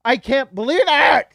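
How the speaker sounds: overjoyed yelling; excited shout. Real, not acted. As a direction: yell it as the news hits. Disbelief bursts into shout.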